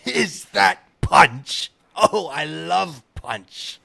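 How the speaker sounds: put-on loud, slow, booming drunk lord; blasting drunken shout. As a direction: bellow it with drunken delight. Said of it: "laughed in a blasting shout".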